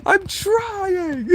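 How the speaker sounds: very high, teary whimpered plea; mock sobbing. Vessel whimpering to Bug in fake tears. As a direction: squeak it through fake tears.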